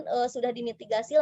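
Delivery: ordinary talk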